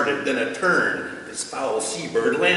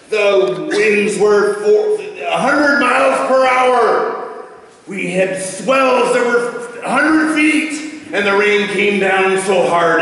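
dramatic storm telling; 5 semitones higher, slower than usual. Acted. Storm told dramatically, slowed.

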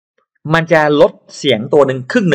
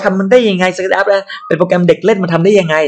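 doubting naysayers; 5 semitones higher than usual. Retold or imitated. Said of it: "หลายคนบอกว่า".

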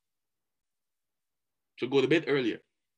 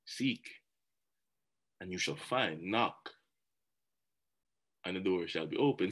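scripture reading; 7 semitones lower, 8 dB quieter than usual. Acted. Quoting Matthew seven to the audience, softly and reverently. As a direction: read the verse reverently and quietly. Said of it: "It says simply this".

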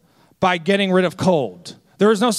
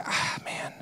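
muttered aside; 14 dB quieter than usual. Retold or imitated. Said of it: "I say".

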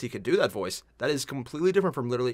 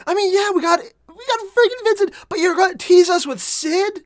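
outraged; 10 dB louder, slower than usual. Real, not acted. Outraged the season ends on tease.

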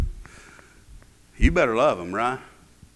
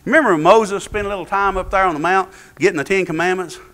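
usual speaking voice

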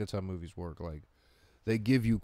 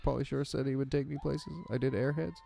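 higher, hesitant, meek impression; Brendan Fraser; 5 semitones higher than usual. Star listing Mummy films, high and hesitant. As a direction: list credits haltingly into the mic. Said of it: "he, like, talks like this into the mic".

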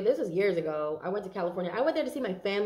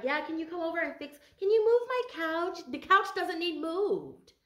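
nagging mother; 8 semitones higher, 4 dB louder than usual. Retold or imitated. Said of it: "I'm hearing somebody's like".

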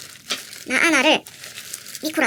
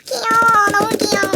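high, loud squeal; excited squeal; 7 semitones higher, 7 dB louder, slower than usual. Real, not acted. Unboxing the lantern for viewers, loud high squeal. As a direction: shriek with delight.

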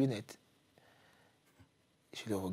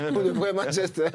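raised pitch, laughing through the words; laughing disbelief; 6 semitones higher, 6 dB louder than usual. Retold or imitated. Player asking coach about Manchester, laughing.

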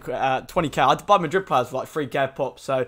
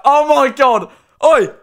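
excited; 8 semitones higher, 9 dB louder, slower than usual. Real, not acted. Still shouting over pack.

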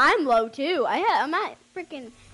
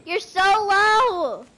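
laughing; 6 semitones higher, 8 dB louder, much slower than usual. Real, not acted. Laughing at teammate's health.

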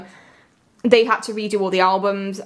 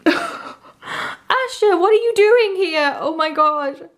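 shocked delight; 8 semitones higher, much slower than usual. Real, not acted. Fan finds her favourite member's card, squealing with surprise. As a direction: call out her name in disbelief.

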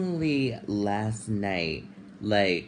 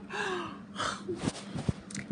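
a high breathless crying gasp, softer; teary gasp; 7 dB quieter than usual. Real, not acted. After showing the bump, a teary, panicked gasp. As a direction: catch your breath mid-sob.

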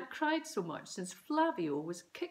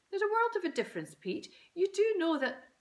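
scolding mum; 12 semitones higher, 6 dB louder than usual. A narrator's reading.

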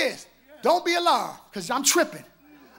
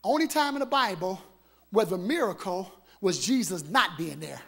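normal talking voice

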